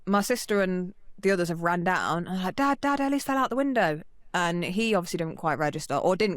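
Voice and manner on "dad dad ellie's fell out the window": calling out high and urgent to dad; a child shouting the news across the house. Retold or imitated. Child calling dad about fall.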